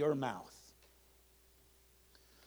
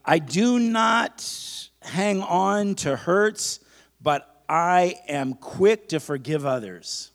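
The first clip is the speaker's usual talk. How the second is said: slow, loud preacher's affirmation; emphatic, stretched-out call for repetition; leading a declaration; 8 dB louder, much slower than usual. Acted. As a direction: proclaim it phrase by phrase for the congregation to echo. Leads a congregation in declarations.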